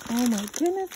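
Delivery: ordinary talk, nothing marked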